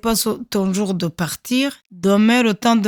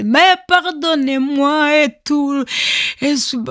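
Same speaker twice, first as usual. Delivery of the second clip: pleading cry; 7 semitones higher, 4 dB louder than usual. Retold or imitated. Woman begging soldiers for mercy, high desperate plea. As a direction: beg soldiers for mercy, voice raised. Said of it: "Je commence à hurler".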